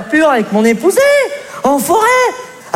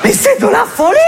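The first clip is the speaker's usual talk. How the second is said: outraged; 8 semitones higher than usual. Acted. Husband outraged at wife.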